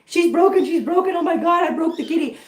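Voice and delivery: half-mock panic, voice up high; panicked crying. Retelling her first kitten fall, in mock panic. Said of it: "I cried. I was like".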